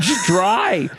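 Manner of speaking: high incredulous jab; mock disbelief. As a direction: tease him for eating it dry. Mocking friend's plain hot dog.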